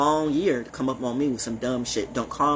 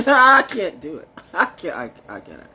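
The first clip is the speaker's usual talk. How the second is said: laughing refusal; 5 semitones higher, 7 dB louder than usual. Real, not acted. Refusing a drink from Robinson County, laughing. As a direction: laugh as you refuse, high and loud.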